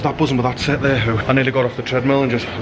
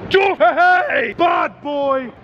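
shouting out; 12 semitones higher, much slower than usual. Real, not acted. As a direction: hail a mate from afar. Shouting to mate across street.

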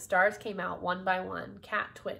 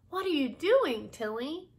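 high, tentative little question; timid; 6 semitones higher than usual. A narrator's reading. Miss B watching Tilly churn, asking timidly. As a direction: ask it timidly, pitched up. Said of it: "Miss B asked timidly".

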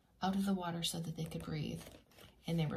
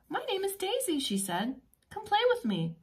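put-on high, bright little dolphin girl; cheerful Daisy; 13 semitones higher than usual. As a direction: invite him to play sweetly. Voicing friendly girl dolphin Daisy.

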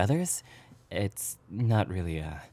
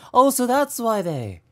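dawning realization; 13 semitones higher, 7 dB louder than usual. Real, not acted. Realizing the dance is clap.

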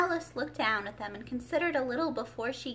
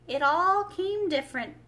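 thoughtful; 8 semitones higher, 8 dB louder than usual. A narrator's reading. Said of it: "repeated thoughtfully".

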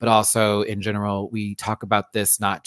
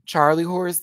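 ordinary talk, nothing marked

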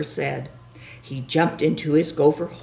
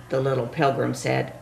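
plain reading voice

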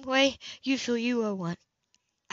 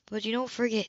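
usual speaking voice

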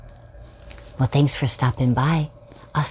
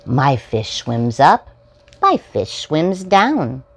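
bright, sing-song picture-book reading; reading to children; 3 semitones higher, 4 dB louder than usual. Acted. Host reading a picture book to young viewers, storytime voice. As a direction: read it out like storytime for little ones.

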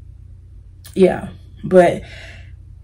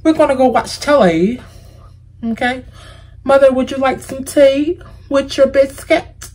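higher-pitched, louder mock British voice; attempted British accent; 6 semitones higher, 3 dB louder than usual. Shown as a demonstration. Vlogger mimicking British talk to Mother over tea, amateur British accent. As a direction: play at being British, not quite convincingly. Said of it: "I'm trying to do it".

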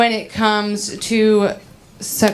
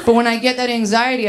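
ordinary talk

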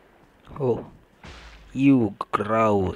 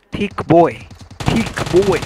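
taunting shout; 5 semitones higher, 5 dB louder, slower than usual. Real, not acted. Taunting a game enemy.

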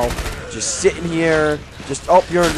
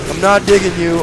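ordinary talk, nothing marked

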